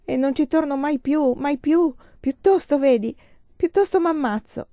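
distraught, as if crying to a friend; tearful outburst. A narrator's reading. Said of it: "Piangendo si strinse all'amica".

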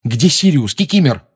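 firm, loud demanding question. Acted. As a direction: demand an answer. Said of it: "требовательно спросил Гарри".

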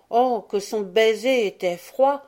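a touch of startled outcry; mild exclamation. A narrator's reading. Pivoine reacting to the cold kiss, mildly exclaiming. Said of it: "s'écria Pivoine".

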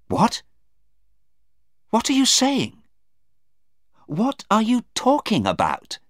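very high, loud disbelief; shocked cry. Acted. Anna to her daughter at startling news, crying out in shock. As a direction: cry out in stunned alarm. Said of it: "Anna cried".

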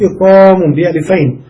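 ordinary delivery

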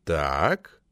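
low, careful agreement; cautious. A narrator's reading. Committee member warily going along with the speaker, cautious. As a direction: agree warily, dropping the pitch. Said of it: "осторожно согласился Сухонин".

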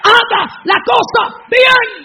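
"Haga la cosa bien" shouted high, loud and slow; preaching shout. Real, not acted. Preacher hammering advice to the wives, slow high shout.

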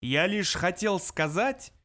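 big slow computer roar; thunderous roar. A narrator's reading. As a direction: boom it out slowly over the noise. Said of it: "оглушительно взревел компьютер".

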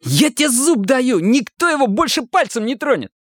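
high-pitched, insistent assurance; eager promise. A narrator's reading. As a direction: promise it hard, pitched well up. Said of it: "пообещал киса Олегу". (горячее обещание высоким голосом)

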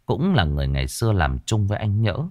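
plain reading voice

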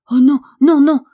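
high, loud pleading shout; crying out. A narrator's reading. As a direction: cry out in desperate protest. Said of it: "cria-t-elle".

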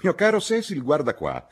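high, loud call for attention; insistent mother. A narrator's reading. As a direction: cut in and demand his attention. Mother overrides Cecil to demonstrate.

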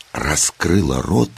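plain reading voice

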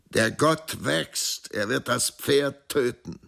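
high, drawn-out proclamation; exclaiming. A narrator's reading. Dancer to his elder daughter, exclaiming. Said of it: "rief er aus".

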